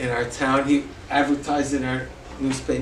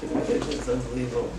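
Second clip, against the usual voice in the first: usual speaking voice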